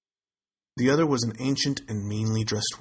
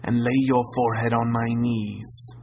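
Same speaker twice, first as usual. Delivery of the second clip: commanding crone. A narrator's reading. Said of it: "she said".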